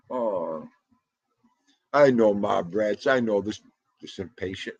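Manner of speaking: ordinary delivery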